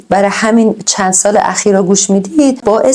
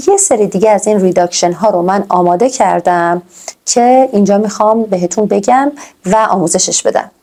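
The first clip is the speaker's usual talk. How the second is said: ordinary delivery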